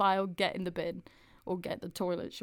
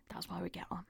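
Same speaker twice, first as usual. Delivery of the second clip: whispered aside; 2 semitones lower, 12 dB quieter, faster than usual. Real, not acted. Closing aside.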